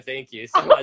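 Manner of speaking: laughing thanks, high and loud; laughing, flustered. Real, not acted. Host thanking guest while blushing, laughing. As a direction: laugh it out, bashful and thrilled. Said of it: "I'm blushing".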